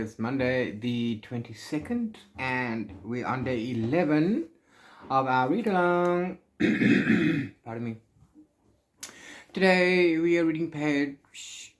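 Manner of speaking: usual speaking voice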